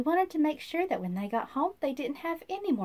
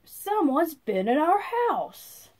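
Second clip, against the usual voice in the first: dwarf voice; 4 semitones higher, 6 dB louder, slower than usual. A narrator's reading. Dwarf voiced finding surprise.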